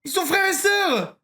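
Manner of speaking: high, drawn-out exclamation; exclaimed realisation. Real, not acted. Streamer realising the siblings share an accent, high outcry. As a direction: cry it out high and slow, as if it's obvious.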